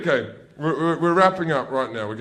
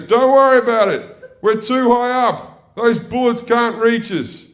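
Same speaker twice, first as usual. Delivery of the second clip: high, loud shout over the noise; helicopter gunner; 10 semitones higher, 6 dB louder than usual. Acted. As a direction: gunner yelling back across the cabin. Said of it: "the gunner turns around and goes".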